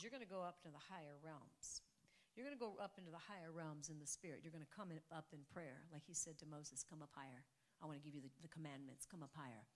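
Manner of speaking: soft, low, intimate sermon voice; hushed preaching. Acted. Pastor quietly teaching the congregation about prayer, hushed. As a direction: draw them in close and quiet.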